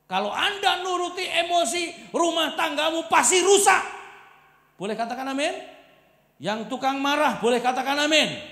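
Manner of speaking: loud, high sermon shout; full-force preaching. Acted. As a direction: drive the point home to the hall. Preacher exhorting the congregation.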